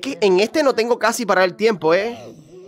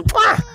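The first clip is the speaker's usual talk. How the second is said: high smacking kiss sound. Acted. Cheeky player blowing a kiss, high kiss sound. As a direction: blow a mock kiss.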